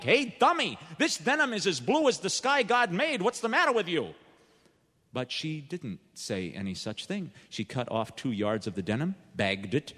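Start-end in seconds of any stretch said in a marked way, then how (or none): 0.0-4.1 imagined blunt scolding, pitched up. Retold or imitated. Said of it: "say something like".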